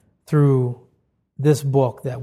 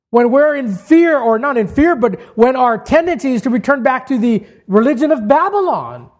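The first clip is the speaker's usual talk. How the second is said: high, loud, building preacher's cry; full-force preaching; 7 semitones higher, 5 dB louder than usual. Acted. Preacher urging his congregation back from Babylon, impassioned. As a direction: preach it hard, rising to a climax.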